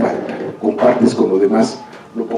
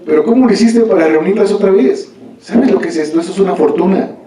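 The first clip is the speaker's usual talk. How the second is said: higher, amazed voice of the king; astonished king; 7 semitones higher than usual. Acted. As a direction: marvel at the fortune, pitch up.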